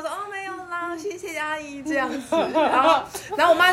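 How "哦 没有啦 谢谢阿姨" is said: softly, a bit higher, shy and polite; shy soft reply. Retold or imitated. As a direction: bashfully decline. 害羞的哥哥姐姐婉拒. (害羞地轻声回答)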